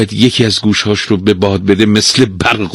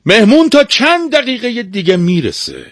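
urgent master. Acted. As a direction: urge the servant on, pitched way up. Master urging servant before guest arrives.

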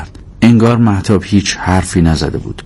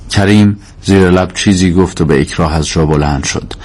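plain reading voice

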